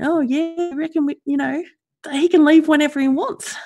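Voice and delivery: high, sneering imitation of others; mock them in a high silly voice. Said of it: "standing around going".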